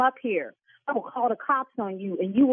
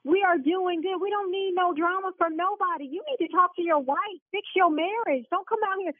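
heated scolding; 7 semitones higher than usual. Real, not acted. Owner rebukes threatening caller.

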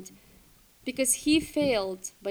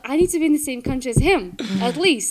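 urgent resolve; 5 semitones higher, 12 dB louder than usual. Retold or imitated. Panicked resolve to follow boyfriend.

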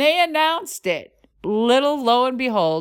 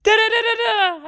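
engine noise mimic; 12 semitones higher than usual.

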